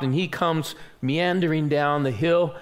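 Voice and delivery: ordinary delivery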